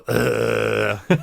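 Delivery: long flat-footed droned "uhh"; off-key groan. Retold or imitated. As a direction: botch the note with a tuneless held groan. Imitates failing to match the tone.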